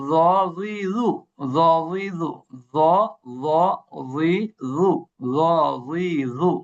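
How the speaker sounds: জ, জি, জু repeated in quick steady beats; drilling letter sounds. Shown as a demonstration. Teacher drilling the class on জ জি জু. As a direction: chant জ জি জু over and over. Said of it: "আর এরপরে হলো".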